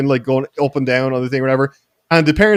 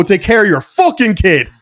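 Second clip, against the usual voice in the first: raised, higher voice, re-enacting the telling-off; retold angry outburst; 5 semitones higher, 4 dB louder than usual. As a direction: act out losing patience with the dad. Retold snapping back at dad.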